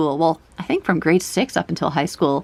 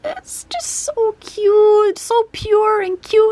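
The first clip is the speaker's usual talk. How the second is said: high-pitched squeak. Real, not acted. Fan overwhelmed by all the cuteness, high-pitched squeak. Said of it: "that high-pitched squeak there".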